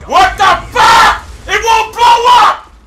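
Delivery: high, loud disbelief; outraged shouting. Real, not acted. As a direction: yell in real outrage at a failed trick. Gamer's explosive fails.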